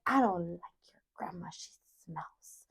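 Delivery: imitated sly whisper, very soft; teasing whisper. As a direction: whisper it to needle a child. Grandma's sly teasing, retold in whisper.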